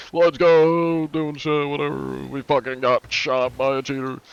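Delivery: loud, high hype shout that settles down; cheering mid-game, then deflating; gamer celebration. Retold or imitated. Streamer retelling his own in-game celebration, loud hype. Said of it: "when I, like, kinda have celebrations".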